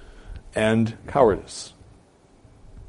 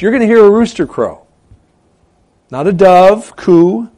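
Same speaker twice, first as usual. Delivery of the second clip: insistent, higher and louder on "rooster crow"; emphatic; 10 semitones higher, 9 dB louder than usual. Acted. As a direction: hammer "rooster", stretch out "dove coo". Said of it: "He's emphatic about the sign".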